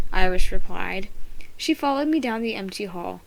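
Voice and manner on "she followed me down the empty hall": plain reading voice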